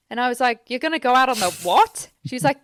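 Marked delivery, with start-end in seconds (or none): 0.7-2.1 higher, louder baffled question; incredulous. Retold or imitated. Retelling her reply to the friend, incredulous. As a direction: act out disbelief, rising at the end.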